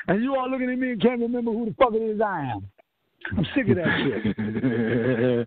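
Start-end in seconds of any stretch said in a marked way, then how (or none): 3.4-5.5 slow, low, crying voice, breaking; near tears. Real, not acted. Preacher forgotten by his family, crying it out slowly. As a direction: let it crack into a sob, dragged out. Preacher's grief at not being remembered.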